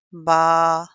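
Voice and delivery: बा held about a second, strong and loud; stretched ba. Shown as a demonstration. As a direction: draw out बा for one alif count. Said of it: "उसको हम थोड़ा सा खींच कर पढ़ेंगे".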